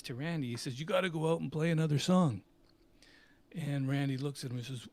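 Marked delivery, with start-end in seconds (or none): 0.8-2.4 fast, loud, insistent order; pushy manager. Retold or imitated. Manager ordering the band back on stage, fast and loud. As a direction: push them back on stage.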